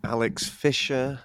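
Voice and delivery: ordinary talk, nothing marked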